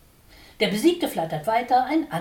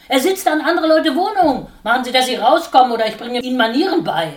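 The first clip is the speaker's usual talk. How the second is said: loud, high, fast angry yelling; furious shout; 5 semitones higher, 10 dB louder than usual. A narrator's reading. Watscheline yells at the sparrow to get out, loud and fast. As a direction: throw him out with your voice.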